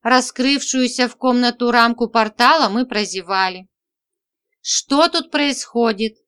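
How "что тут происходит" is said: plain reading voice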